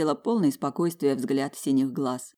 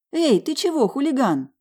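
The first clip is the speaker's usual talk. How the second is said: high, louder teasing reproach; playful scolding; 10 semitones higher, 4 dB louder than usual. Acted. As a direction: chide the little rascal. Mother scolds biting baby.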